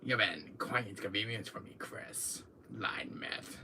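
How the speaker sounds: whispered smug villain; Wesker impression. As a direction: menace him in a hush. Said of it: "My little intro".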